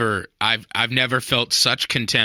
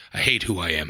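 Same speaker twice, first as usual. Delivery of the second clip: low, slightly subdued confession; mock self-loathing; 6 semitones lower, 4 dB quieter, faster than usual. Co-host confessing what he said at dinner, mock self-loathing. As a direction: drop it low, like a half-joking admission.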